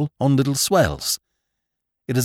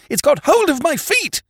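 even higher shrieked repeat; second mate screaming, pitch far higher; terrified scream. A narrator's reading. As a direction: shriek it as the panic peaks. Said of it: "he screamed".

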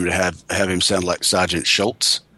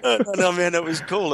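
laughing; 10 semitones higher than usual. Real, not acted. Laughs while answering interviewer.

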